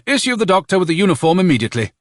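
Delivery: fast, high-pitched military order; urgent officer's instruction; brisk command. A narrator's reading. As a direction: rap out the order quickly. Colonel giving an order.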